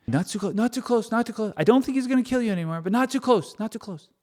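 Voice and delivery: high, fussy worried warning; anxious leader keeping people at a distance; Moses fussing. Acted. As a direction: shoo the crowd back nervously.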